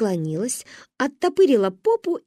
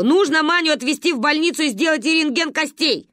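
raised, urgent plea; shouting into phone; 6 semitones higher than usual. A narrator's reading. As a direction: call urgently down the line. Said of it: "кричала она в трубку". (крича в трубку, взволнованно)

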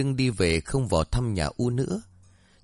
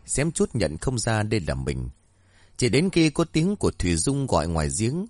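ordinary delivery

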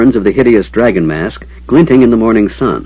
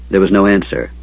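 ordinary delivery, nothing marked

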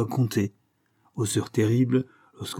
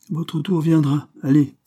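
a hint of a dismissive jailer, raised voice; lightly voiced jailer; 6 semitones higher, 5 dB louder than usual. A narrator's reading. Jailer putting off the anxious prisoner, dismissive. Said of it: "répondait invariablement le geôlier".

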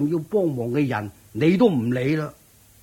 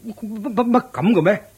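stammering shock; 7 semitones higher, 4 dB louder than usual. Acted. Shocked at the witch story.

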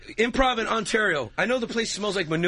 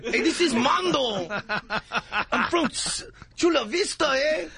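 put-on high Chicano-accented character voice; Mando; 8 semitones higher than usual. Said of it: "They talk like this".

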